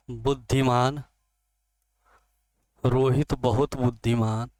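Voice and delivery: loud, word-by-word dictation with long pauses. Shown as a demonstration. Teacher dictating an example sentence to the class, loud and word by word. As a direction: spell it out so students can write it down.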